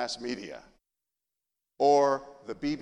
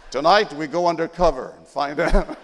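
mock news anchor; 2 semitones higher, 11 dB louder than usual.

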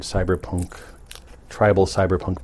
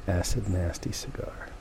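breathy whisper of the name; 12 dB quieter than usual. Pretend. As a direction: whisper it like a secret. Whispers cigar name for show.

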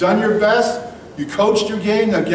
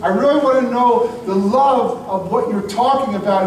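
heartfelt prayer; 6 semitones higher than usual. Pretend. Sinner praying to God, earnestly pleading. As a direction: plead with God to take you back. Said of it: "where you said".

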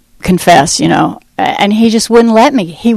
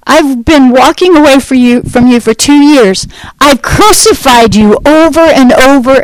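retold scream; 4 semitones higher than usual. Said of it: "And I screamed at him".

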